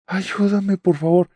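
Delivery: high, strained plea for help; desperate plea. Retold or imitated. Still trapped in the dream, begging her husband for help. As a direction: beg for help with everything left. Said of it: "con todas mis fuerzas".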